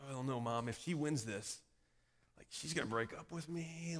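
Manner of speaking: quiet, low, worried confession; mildly anxious, hushed and low; freaking out. Retold or imitated. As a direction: confide the worry under your breath. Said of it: "I'm kinda freaking out".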